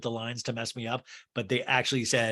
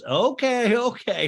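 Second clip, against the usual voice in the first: higher, brighter calming Okay; high mock Okay; 9 semitones higher, 5 dB louder, slower than usual. Kyle the ringmaster.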